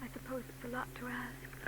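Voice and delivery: hushed, hesitant whisper; soft whispered plea. Acted. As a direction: ask it under her breath. Hesitant request to detective.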